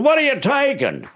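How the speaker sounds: high, pressing question; homeowner demanding. Retold or imitated. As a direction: demand an answer from the burglar. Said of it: "He said".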